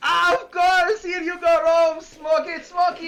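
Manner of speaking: high, loud, gleeful character voice; merry Panda. Acted. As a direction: offer it with loud, high delight. Game master voices Panda offering smoke.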